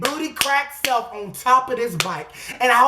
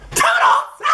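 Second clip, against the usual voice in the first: very high squealed goodbye; gleeful sign-off; 4 dB louder than usual. Pretend. Comic sign-off after the threat.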